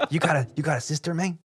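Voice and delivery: a lightly voiced SoCal drunk; imitating the guy. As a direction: rattle it off like a pushy drunk. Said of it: "And he goes".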